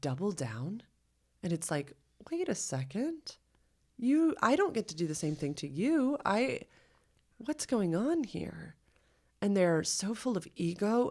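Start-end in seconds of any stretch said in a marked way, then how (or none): 2.3-8.7 high, affronted mimic of a defensive grown-up; lightly voiced indignant adult; mock indignant adult. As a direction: play the offended adult who can't take a joke.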